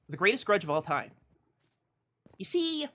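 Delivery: ordinary talk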